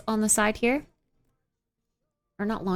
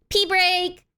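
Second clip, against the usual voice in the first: excited announcement; 8 semitones higher, 4 dB louder than usual. Real, not acted. Announces leaving for toilet.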